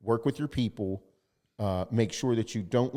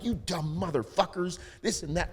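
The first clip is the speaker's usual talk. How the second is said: higher mock cursing; mock outburst; 8 semitones higher than usual. Client ranting at careless vendors, mock angry. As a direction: fling the insult in a hammed-up rant.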